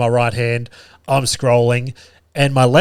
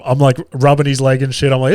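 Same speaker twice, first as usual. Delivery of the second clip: ordinary talk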